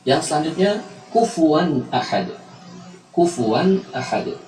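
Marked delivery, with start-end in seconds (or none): 1.1-4.3 kufuwan ahad slowly, the n sounded clearly before the a; drawn-out kufuwan with a distinct n, then ahad; clear izhar. Shown as a demonstration. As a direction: recite kufuwan ahad without merging the n. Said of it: "Maka diucapkan secara jelas".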